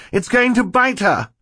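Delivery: very high-pitched alarmed scream; screamed warning. A narrator's reading. Boy sees snake about to bite sister, high scream. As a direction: scream out the danger. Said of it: "Klaus screamed".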